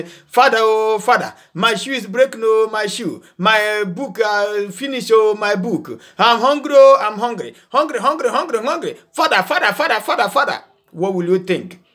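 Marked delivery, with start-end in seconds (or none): 0.0-10.6 higher, nagging whine that repeats itself; whining demanding child. Retold or imitated. Son nagging his father for shoes and food, high whining. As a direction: whine like a spoiled child begging without end. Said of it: "he comes to you and say".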